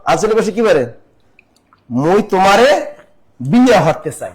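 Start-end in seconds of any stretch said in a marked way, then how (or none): 1.9-4.3 loud, proud Barishal dialect: মই তোমারে with a rising end. Shown as a demonstration. বরিশালের আঞ্চলিক টানে জোরে বলা. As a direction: show students the Barishal way, louder. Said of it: "Barishal আঞ্চলিক ভাষায় কি বলে?".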